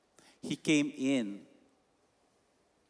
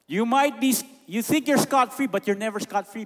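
loud preaching; 9 semitones higher, 6 dB louder than usual. Real, not acted. Preacher's rising warning.

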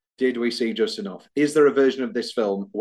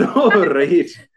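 put-on Welsh accent; 5 semitones higher, 7 dB louder than usual. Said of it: "I said".

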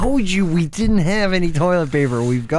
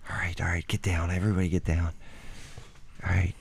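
much softer, hushed urgent whisper; 10 semitones lower, 12 dB quieter, faster than usual. Acted. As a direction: keep it low so she can't hear. Hiding from shooter.

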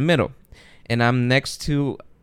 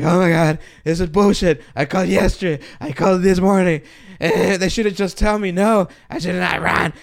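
higher, heated complaining to the other passengers; angry rant; 6 semitones higher than usual. Retold or imitated. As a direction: vent to the guys behind you, fed up. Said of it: "going nuts".

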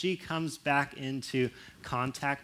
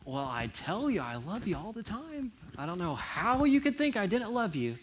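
higher, rising protest of love; defensive protest; 7 semitones higher than usual. Retold or imitated. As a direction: insist you love them, a bit offended. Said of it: "you can go".

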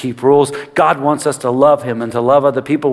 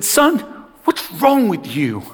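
high-pitched exasperated telling-off; retold parental tirade, pitched up; father's angry scolding; 7 semitones higher than usual. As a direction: rattle off accusing questions at a wayward son. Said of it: "I just let him have it".

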